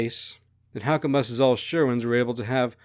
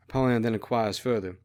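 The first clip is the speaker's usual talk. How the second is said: usual speaking voice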